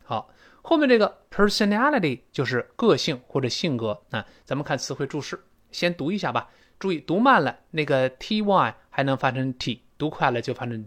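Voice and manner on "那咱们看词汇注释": ordinary talk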